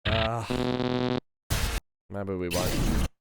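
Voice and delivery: ordinary talk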